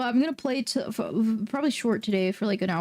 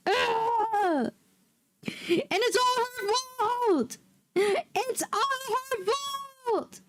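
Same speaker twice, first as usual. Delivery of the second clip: high, drawn-out whiny wail; wailing in mock despair; 10 semitones higher, much slower than usual. Real, not acted. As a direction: wail it like a melodramatic loser. Wailing over the lost match.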